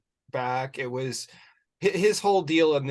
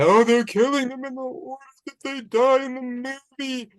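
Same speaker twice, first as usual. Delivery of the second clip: mock quote; 12 semitones higher, 5 dB louder, faster than usual. Quoting fake Gale Weathers.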